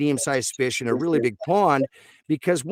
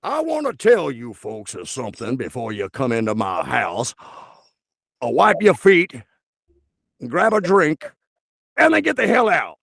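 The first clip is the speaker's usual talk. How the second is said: genteel Southern host turning sharp; Southern gentleman. Acted. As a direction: play a hammy old Southern gentleman. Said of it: "typical, stereotypical Southern, uh, gentleman".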